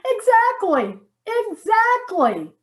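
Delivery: high, emphatic exasperation, drawn out on the repeat; exasperated. Acted. Wife proving her point to her reluctant husband, exasperated. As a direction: drive the point home, pitched high.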